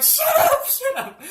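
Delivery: high, loud mock-panicked squeal through laughter; squealed outburst, laughing as he acts it; mortified squeal. Son begs mom to stop in Home Depot, mortified squeal.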